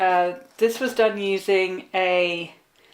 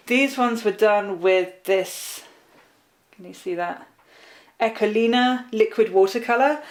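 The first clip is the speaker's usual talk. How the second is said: ordinary talk, nothing marked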